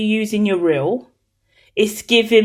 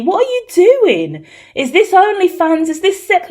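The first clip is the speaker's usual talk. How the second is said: mock shock; 9 semitones higher, 3 dB louder than usual. Real, not acted. Shocked reaction to a card.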